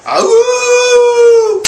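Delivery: high, loud copycat wolf howl; kid's howl at the moon. Retold or imitated. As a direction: howl fast to impress dad. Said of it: "I go".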